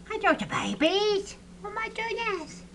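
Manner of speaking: high-pitched cooing. Real, not acted. Keeper greeting the hatchlings, high baby talk. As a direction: coo high at tiny animals.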